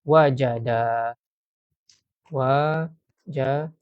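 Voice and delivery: wajada drawn out slowly, every syllable wa-ja-da clear; very slow dictation of wajada. Shown as a demonstration. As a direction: stretch out wajada for students to repeat. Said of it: "fi'il madinya ialah".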